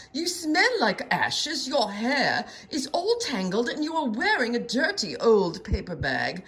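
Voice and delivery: slow, haughty disdain; snooty prince. A narrator's reading. Prince Ronald scolding Elizabeth for her looks, haughty. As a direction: look down your nose at her.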